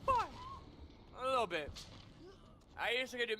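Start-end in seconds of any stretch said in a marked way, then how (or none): 0.1-0.2 high squeaky cartoon mouse voice; Mickey Mouse. Shown as a demonstration. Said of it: "Like the, the".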